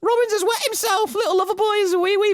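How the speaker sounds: very high mocking singsong taunt; taunting bully. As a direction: sneer it in a shrill mocking falsetto. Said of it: "the class bully storms in".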